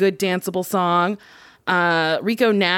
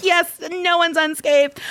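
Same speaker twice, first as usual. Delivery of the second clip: high excited quote; 9 semitones higher than usual. Retold or imitated. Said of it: "And I was like".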